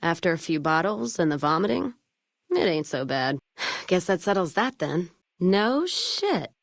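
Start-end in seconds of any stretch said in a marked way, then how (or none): 5.4-6.5 surprised. Acted. Surprised reaction to news.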